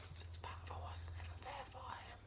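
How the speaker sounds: very soft, voiceless whisper; whispered aside. Real, not acted. Reviewer on Ryan's absent father, whispered aside. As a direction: drop to a confiding whisper.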